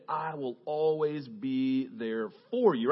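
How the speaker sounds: slow, soft fatherly promise; tender father. A narrator's reading. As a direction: reassure the boy gently.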